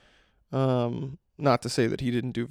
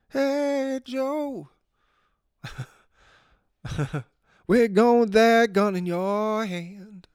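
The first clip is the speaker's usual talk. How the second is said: high, slow, sing-song speaky drawl; half sing the words like a laid-back rock singer; 9 semitones higher, much slower than usual. Retold or imitated. Podcaster imitating a rock legend for listeners, high sing-song delivery. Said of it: "speaky sing-song kind of delivery".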